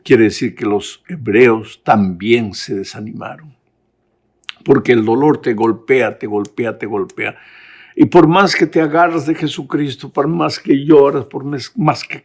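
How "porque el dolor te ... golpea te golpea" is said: ordinary delivery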